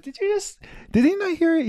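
mock-astonished at the character; incredulous. Real, not acted. Laughing at the character's obliviousness.

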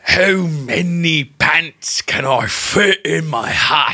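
put-on very slow English accent, slipping downmarket; laboured British accent that coarsens as it goes. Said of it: "progressively lower class as you did that".

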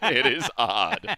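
very slow mock Australian; playful, stretched Australian accent. Shown as a demonstration. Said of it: "when I do my Australian".

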